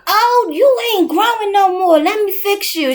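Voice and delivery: high, fussy mock-worried plant talk; mom fussing at plants. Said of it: "she done named them".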